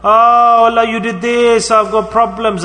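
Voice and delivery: loud, drawn-out mock wail of complaint; complaining. Weak believers protesting their problems to Allah, complaining. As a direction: whine to Allah with a high, stretched "Oh". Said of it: "we start to complain to Allah".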